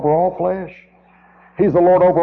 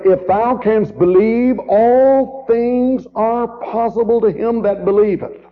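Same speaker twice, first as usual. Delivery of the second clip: slow, raised solemn scripture voice; solemn Jesus; 6 semitones higher, slower than usual. Acted. Preacher quoting Jesus to the congregation, slow and solemn. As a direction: proclaim it slowly like Jesus. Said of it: "And Jesus said unto him".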